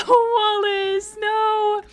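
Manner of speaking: high, loud laughing no; laughing loud plea to her friend Wallace; laughing protest. Real, not acted. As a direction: cry out to stop him leaving, laughing. Pleading friend not to leave.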